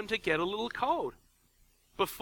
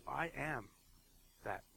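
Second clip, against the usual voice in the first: quiet; 4 semitones lower, 13 dB quieter than usual. Shown as a demonstration. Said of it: "what they hear is".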